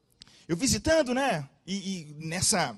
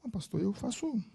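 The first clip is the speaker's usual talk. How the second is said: softer, hesitant and embarrassed; shy and ashamed; 6 dB quieter than usual. Retold or imitated. Ashamed girl telling the pastor her studies, softly. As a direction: say it shyly, almost ashamed. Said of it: "toda envergonhada".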